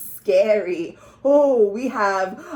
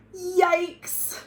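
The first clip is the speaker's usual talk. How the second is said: shocked yikes; 6 semitones higher than usual. Acted. Shocked at costume.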